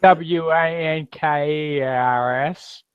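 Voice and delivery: slow, letter by letter; drawn-out spelling; spelled out. Pretend. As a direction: spell it out slowly, each letter separate. Spells the word out slowly.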